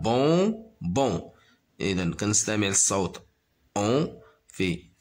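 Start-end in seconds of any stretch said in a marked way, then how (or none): 0.0-1.3 "Bonbons" in two separated beats; stretched, segmented "Bonbons"; syllable by syllable. Shown as a demonstration. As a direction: sound out "Bonbons" as bon, pause, bon. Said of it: "se compose de deux syllabes".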